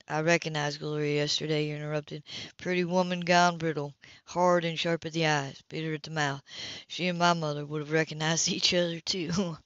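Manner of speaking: a hint of a laid-back brother; Phillip's voice. A narrator's reading. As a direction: voice him relaxed and dry.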